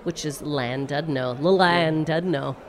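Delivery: Llandudno, slow and carefully spelled out; pronunciation. Shown as a demonstration. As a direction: sound out Llandudno slowly. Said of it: "pronouncing place names".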